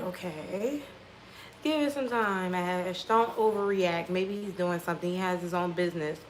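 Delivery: low, hushed talk to herself; quiet self-reassurance. Retold or imitated. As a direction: softly talk yourself down. Talking herself out of overreacting.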